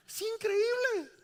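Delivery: high, loud, drawn-out amazement; amazed exclamation. Real, not acted. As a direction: exclaim it in sheer wonder. Marvels at mothers' understanding.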